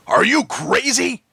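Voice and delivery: slow, stunned question; incredulous biker. A narrator's reading. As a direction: drag it out in disbelief. Harvey shocked.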